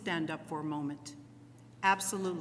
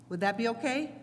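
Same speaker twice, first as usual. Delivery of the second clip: ordinary talk, nothing marked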